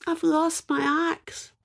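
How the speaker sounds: high, slow whimper of a crying man; mock sad. Seamus crying to the fairy, a touch of mock sobbing. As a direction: wail it like a sorry woodcutter. Woodcutter crying over axe.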